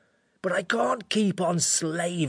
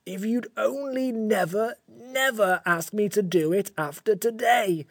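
slow, plaintive creature lament; sorry-for-itself creature, close to tears; tearful; slower than usual. A narrator's reading. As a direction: pour out the misery, stretching each word. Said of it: "it said in a tearful voice".

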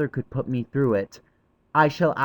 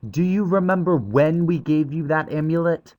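put-on high boy's voice, blurting it out; sudden question; 11 semitones higher, 4 dB louder than usual. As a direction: ask it suddenly, as a boy. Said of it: "Cyril asked suddenly".

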